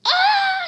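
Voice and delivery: high, loud shriek of shock; mom's scream. Acted. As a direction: scream as if seeing a ghost. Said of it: "She starts screaming".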